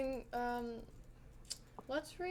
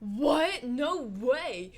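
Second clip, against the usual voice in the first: louder, hammed-up astonishment; all-caps disbelief; 6 dB louder, much slower than usual. Friend reacting by text to a close call, all-caps disbelief. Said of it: "all capitals".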